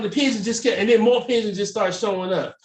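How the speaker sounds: normal talking voice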